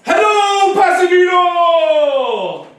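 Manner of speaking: loud, high shout to the whole street; calling out to a crowd. Shown as a demonstration. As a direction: grab the crowd's attention with a big hello. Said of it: "How do you attract a crowd?".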